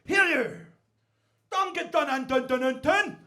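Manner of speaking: mock angry shout; shouted confrontation. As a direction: yell across the bar, acting it out. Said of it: "he shouts at somebody".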